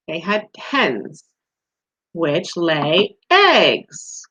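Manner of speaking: slow and deliberate, every word laid out; patient slow teacher answer; slow explaining. Shown as a demonstration. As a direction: lay the answer down slowly for children.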